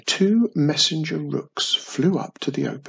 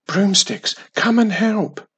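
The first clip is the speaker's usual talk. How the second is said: higher and louder call for help; mild scream; 7 semitones higher, 6 dB louder than usual. A narrator's reading. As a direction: Wiz calling her brooms to help. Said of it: "screamed Wiz".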